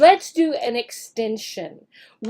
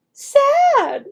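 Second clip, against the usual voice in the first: much higher, louder word; modeled word; 13 semitones higher, 6 dB louder than usual. Shown as a demonstration. Teacher models the word sad, much higher. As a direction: pitch it up for the child. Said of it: "underline sad".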